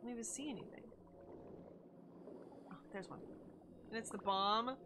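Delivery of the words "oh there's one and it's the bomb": ordinary talk, nothing marked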